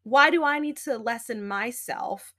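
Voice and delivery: normal talking voice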